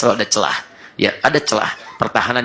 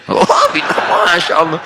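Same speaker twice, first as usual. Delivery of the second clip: mock shocked wife; 5 semitones higher, slower than usual. Wife horrified at husband's prayer posture, shocked high exclamation. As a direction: gasp it in horror.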